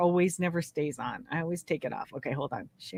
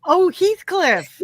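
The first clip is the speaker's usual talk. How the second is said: swooning fan; 10 semitones higher, 7 dB louder, slower than usual. Acted. Swooning over the romance hero.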